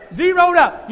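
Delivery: loud, high, triumphant shout. Acted. Pastor shouting to the church that the debt is gone. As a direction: bellow it like a victory cry.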